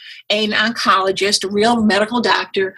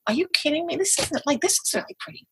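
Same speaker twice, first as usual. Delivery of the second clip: incredulous inner voice; 7 semitones higher, 4 dB quieter than usual. Retold or imitated. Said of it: "I'm like".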